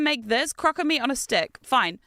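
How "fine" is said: ordinary talk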